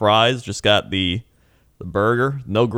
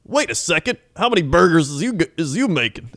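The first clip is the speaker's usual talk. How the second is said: put-on old trucker; 8 semitones higher than usual. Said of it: "he looks to all of us and goes".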